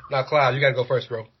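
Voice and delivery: ordinary talk, nothing marked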